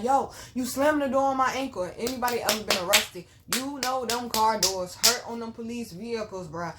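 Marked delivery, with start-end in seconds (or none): 0.0-1.9 louder, higher-pitched call of complaint; calling out to get their attention; retold protest. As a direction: re-enact an indignant shout. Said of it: "I'm like".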